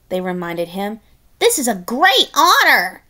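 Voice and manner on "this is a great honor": higher, louder hollow cheer; mock encouragement. Pigs urging Tiny Pig, higher hollow cheer. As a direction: cheer him on insincerely.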